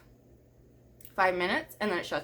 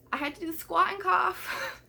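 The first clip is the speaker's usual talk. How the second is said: high-pitched laughter running through the words; laughing while telling; 10 semitones higher than usual. Real, not acted. To the viewers about the period check, laughing. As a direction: giggle through the embarrassing part.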